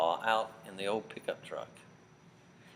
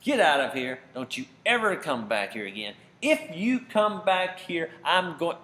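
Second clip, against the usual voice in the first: a much louder and higher furious shout; angry scolding; 7 semitones higher, 8 dB louder than usual. Acted. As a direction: roar at the boys to get off his land. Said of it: "he scolded them and said".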